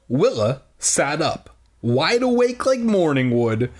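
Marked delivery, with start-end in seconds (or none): none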